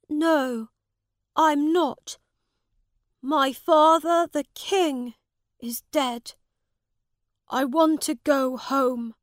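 put-on high, loud upset young prince; grieving his dead father; sad boy king. A narrator's reading. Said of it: "and he was sad".

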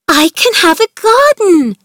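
high, loud joyful outburst; excited cry. Acted. Mary bursting in to tell Martha, excited cry. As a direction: burst out with joy. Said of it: "Mary cried, excited".

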